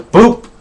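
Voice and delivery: loud, playful "boop"; punchy cartoonish boop; imitated shove noise. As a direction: pop out a comic sound effect for a tumble.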